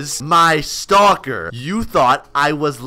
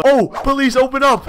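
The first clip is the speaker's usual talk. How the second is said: ordinary delivery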